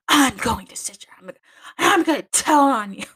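high, loud mocking tattletale; sing-song threat to tell on her, like a kid; childish tattle. Mistress threatening like a child to tell the superior. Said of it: "the superior".

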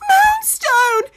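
high frantic call; crying out. A narrator's reading. Farmer woman calling for her lost hen, frantic high call. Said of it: "she cries out".

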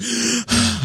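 exhausted breathy sigh, high-pitched; winded high sigh. Pretend. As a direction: heave a tired sigh. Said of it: "I gotta catch my breath".

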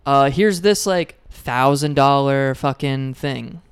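loud, slow mock sales pitch; mocking salesman. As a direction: ham up a pushy seller. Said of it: "are just like".

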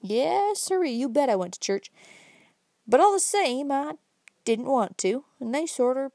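a lightly voiced old country man, rising as he goes; folksy old fellow building up his point, slowing at the end; old Uncle Bobby. Old man lecturing the young man about smoking, lightly voiced and folksy.

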